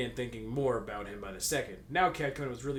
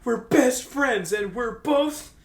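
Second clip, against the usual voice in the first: flustered character; 11 semitones higher, 10 dB louder, much slower than usual. Acted. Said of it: "he shouted in his head".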